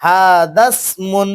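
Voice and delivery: হাদাসমুন drawn out slowly, joined with no alif sound; teacher's slow model reading. Shown as a demonstration. Teacher repeating the correct joined reading, very slowly. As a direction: stretch হাদাসমুন out carefully as one word. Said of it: "এখানে পড়তে হবে".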